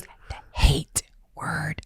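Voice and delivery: put-on slow, hushed child's confession; drawn-out secretive whisper; retold whisper. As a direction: whisper it like a forbidden word. Child saying forbidden word, retold.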